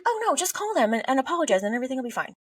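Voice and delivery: high, fast mock fixing parent; high, quick hammed-up reassurance; fussing parent. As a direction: rush to fix it like an anxious parent. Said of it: "It's like".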